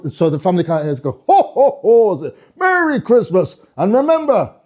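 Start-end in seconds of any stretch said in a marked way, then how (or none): 1.3-2.3 high, hearty ho-ho-ho laugh; Father Christmas. Acted. Father Christmas greeting a visiting family, jolly. 2.6-4.5 high, drawn-out festive greeting; sing-song Santa farewell; Father Christmas. Acted. Father Christmas seeing a family off, jolly and stretched.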